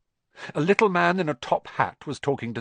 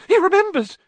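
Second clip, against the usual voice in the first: overjoyed cry, very high and loud; Daedalus Diggle; 10 dB louder than usual. A narrator's reading. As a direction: squeal it out in delight. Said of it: "cried Daedalus Diggle".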